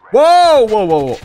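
loud high startled whoa. Real, not acted. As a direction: yelp in alarm. Sudden alarm.

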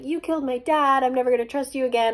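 hammed-up hurt fury, rushing at the end; Marcy. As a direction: hurl it like a melodramatic accusation. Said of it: "And so she's like".

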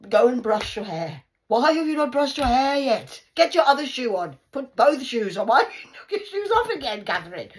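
loud, high exasperated gran; shouting scold, half laughing; nagging shout. Retold or imitated. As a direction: yell at the kid to get ready. Grandma nagging a child.

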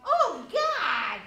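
loud startled cry of disgust; shocked shout. Acted. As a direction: recoil and yell out in horror. Sees half-Dalek face, startled.

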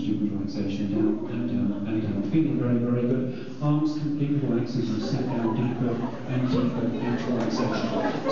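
low, rhythmic hypnotist patter; hypnotic induction voice. Acted. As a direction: lull them down into trance.

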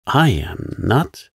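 firm, affronted refusal, drawn out; indignant. A narrator's reading. Molly denies she is engaged to him, indignant. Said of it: "said Molly indignantly".

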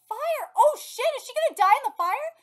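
loud, high shocked reaction; high-pitched, loud disbelief; alarmed outburst. Real, not acted. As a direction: blurt it out in alarm at the story. Reacting to fire in the story.

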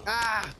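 sudden high yelp of alarm; scared yelp. Real, not acted. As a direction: cry out in fright. Scream in game.

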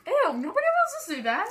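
high, loud grossed-out ew; disgusted. Real, not acted. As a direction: recoil in disgust. Disgusted reaction.

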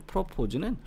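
프로포즈 with 포 pitched high; back-stressed 프로포즈; second-syllable stress. Shown as a demonstration. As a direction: lift sharply on 포 in 프로포즈. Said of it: "뒤에 강세가 있죠".

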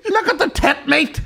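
very high, loud, drawn-out squeal; mock fan shriek. Teasing about the concert-going fan, high hammed-up shriek. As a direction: screech it slowly like an overcome fan.

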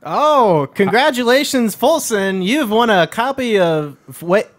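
booming prize announcer; radio voice. Acted. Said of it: "Nice radio voice".

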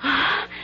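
sharp, soft unvoiced gasp of shock. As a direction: catch your breath in alarm. Shocked at hearing abdicate.

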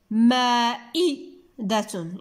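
مائدة with long maa, ii set apart, then datun; slow maa, ii, datun in مائدة; stressed second syllable. Shown as a demonstration. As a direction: split مائدة slowly after maa and ii. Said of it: "سمعناه في بين المقطع الثاني".

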